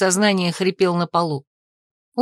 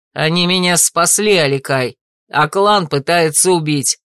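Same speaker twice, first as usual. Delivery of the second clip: firm retort; 3 semitones lower than usual. A narrator's reading. Cain answers the assassin.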